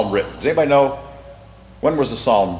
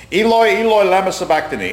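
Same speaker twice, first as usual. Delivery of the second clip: raised, higher quoted cry; retold cry, pitched up and quick at the end; 6 semitones higher than usual. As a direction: recite it as a mild call upward. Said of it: "where Jesus cried out".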